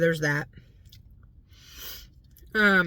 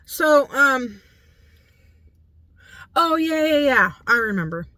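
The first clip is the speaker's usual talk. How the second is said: higher and louder, sudden recall; excited remembering; 6 semitones higher, 6 dB louder than usual. Real, not acted. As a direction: burst out as it comes back.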